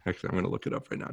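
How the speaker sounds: fast, soft mumbled aside; quick hushed aside. Real, not acted. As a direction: rattle it off under the breath.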